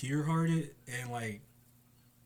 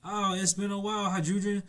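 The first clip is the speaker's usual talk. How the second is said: put-on high, louder happy hello to an old friend; delighted greeting; 7 semitones higher, 5 dB louder than usual. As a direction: greet him, thrilled.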